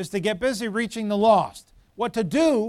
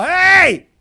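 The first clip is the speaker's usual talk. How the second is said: loud, high shout up a hill; yelled call; 11 semitones higher, 13 dB louder than usual. Retold or imitated. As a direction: holler "hey" to someone far off. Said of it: "I yell".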